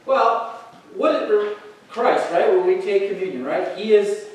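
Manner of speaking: ordinary talk, nothing marked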